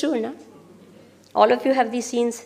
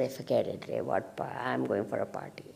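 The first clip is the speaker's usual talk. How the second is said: very low, hushed mutter; sneaky defiance; 14 semitones lower, 18 dB quieter than usual. Acted. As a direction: mutter the defiance almost in a whisper. Body defying the intellect.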